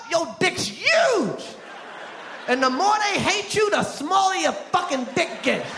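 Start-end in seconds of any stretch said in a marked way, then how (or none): 0.1-1.4 drawn out slowly; slow, drawn-out punchline; slow emphasis. Acted. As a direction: stretch every word. Punchline slowed for effect.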